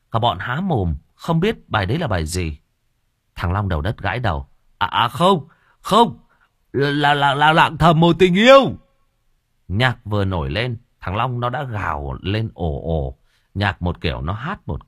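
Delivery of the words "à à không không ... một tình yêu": put-on high, loud drunk correction; drunken shout. A narrator's reading. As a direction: blurt it, drunk. Drunk correcting song name.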